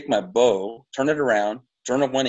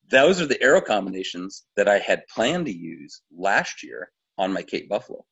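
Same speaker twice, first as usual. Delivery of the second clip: ordinary delivery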